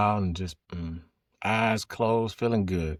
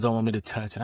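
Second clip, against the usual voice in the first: ordinary talk, nothing marked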